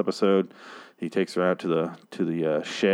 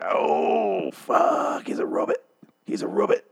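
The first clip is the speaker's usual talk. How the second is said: high, breaking whispered shock; drawn-out gasp of a cry, then whispered disbelief; stunned realization; 12 semitones higher, slower than usual. Retold or imitated. Viewer realizing the character is a robot, mock stunned whisper.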